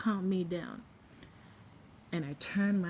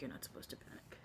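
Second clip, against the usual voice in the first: breathy unvoiced whisper of the friends' warning; whispered friend's advice; 16 dB quieter than usual. Friends coaching her in the pool, whispered. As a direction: hush it like a quick aside.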